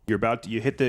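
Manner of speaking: ordinary talk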